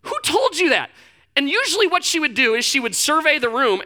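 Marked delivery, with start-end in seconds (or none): 0.0-0.9 incredulous question. Retold or imitated. Uncle questioning niece.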